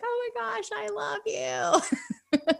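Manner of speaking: breathless, teary, giggly thank-you; gushing delight. Retold or imitated. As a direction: gush at him like he just saved your day. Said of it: "I'm like".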